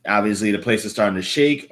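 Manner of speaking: usual speaking voice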